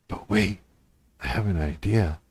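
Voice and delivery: low conspiratorial hush; scheming whisper. Acted. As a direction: drop low like plotting. Sudden quiet low scheming.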